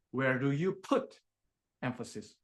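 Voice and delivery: "put" rising and held, then a pause before a low "emphasis"; stress on put. Shown as a demonstration. Coach shows Tasha where to put emphasis, stressing "put". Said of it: "where do you put your emphasis".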